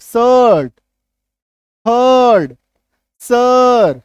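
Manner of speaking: शर्ट, थर्ड, सर said loud with the अ vowel held; loud demo words. Shown as a demonstration. Teacher showing class the hidden अ sound, loud. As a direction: call out each word loudly, leaning on अ. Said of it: "अ छुप गया".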